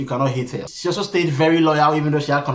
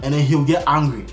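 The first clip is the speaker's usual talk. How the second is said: normal talking voice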